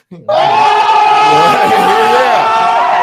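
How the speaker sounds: screeching cackle; loud high laughter. Real, not acted. Host cracks up mid brag, loud high cackle. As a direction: cackle wildly.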